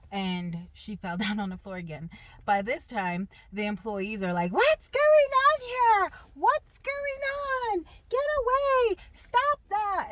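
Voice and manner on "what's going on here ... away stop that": very high, slow panicked voice; shrill panicked employee. Retold or imitated. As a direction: squeal like a panicked store clerk.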